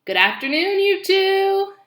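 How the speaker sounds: loud, high cheery goose greeting; old goose Loudwing. Acted. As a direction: call out hello like a chatty old goose.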